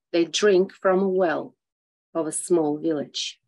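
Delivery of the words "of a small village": ordinary delivery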